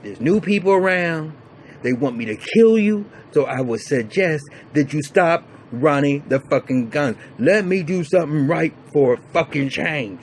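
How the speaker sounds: a retold hard-nosed warning; mock threat. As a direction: warn him straight. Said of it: "he said".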